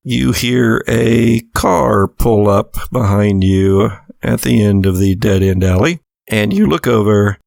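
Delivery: normal talking voice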